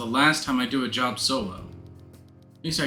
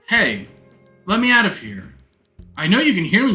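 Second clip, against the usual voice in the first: shouting; 6 semitones higher, 4 dB louder than usual. Acted. Trapped, calling through locked door.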